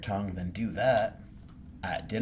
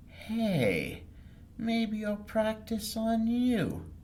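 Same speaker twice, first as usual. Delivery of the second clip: put-on high childlike idea; much slower than usual. Girl talks to her dog.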